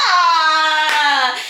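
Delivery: long, loud "aah" of horror; drawn-out loud cry of dismay; horrified groan. Shown as a demonstration. Storyteller reliving her wig being flushed, horrified cry.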